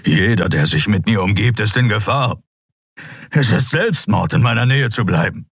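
a lightly voiced gruff beast, grave; a hint of a brooding creature; Skria Keru. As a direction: confess it heavily, like a guilty giant. Keru warns Endriel.

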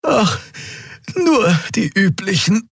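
high breathless creature voice; panting. A narrator's reading. Old Draxyl Xea panting after the escape. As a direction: pant it out, brushing it off. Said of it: "keuchte die Draxyl".

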